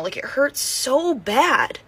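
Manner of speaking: usual speaking voice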